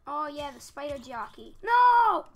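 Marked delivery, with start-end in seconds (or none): 1.6-2.2 very loud, much higher scream; real anguished scream. Real, not acted. Player killed by spider jockey, screaming. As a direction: scream as the character dies. Dying in the game.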